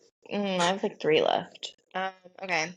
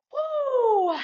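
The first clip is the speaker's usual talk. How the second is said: excited woo. Retold or imitated. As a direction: whoop in delight. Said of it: "I was like".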